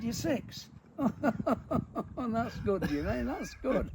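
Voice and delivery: high, laughing mock praise; giggly disbelief. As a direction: tease him while cracking up. Laughing at Jimmy's handicap.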